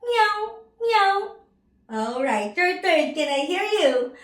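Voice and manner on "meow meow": high-pitched imitated cat meow; teacher's high cat call. Shown as a demonstration. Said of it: "the cat says".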